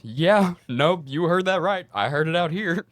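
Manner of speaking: high, loud, knowing confirmation; lobby guy. Retold or imitated. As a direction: call it out from the lobby, amused.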